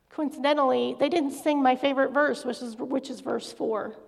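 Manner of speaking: ordinary talk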